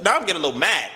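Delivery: ordinary delivery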